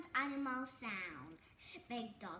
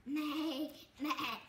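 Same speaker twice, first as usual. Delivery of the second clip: playful bleating meh; sheep bleat. Shown as a demonstration.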